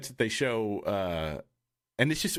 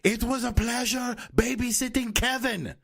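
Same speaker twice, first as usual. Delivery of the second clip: put-on angry German accent; 11 semitones higher than usual. Sketch German saying nice things, in an angry German accent. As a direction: bellow it slowly in a hammed-up German accent. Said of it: "said in a really angry German accent".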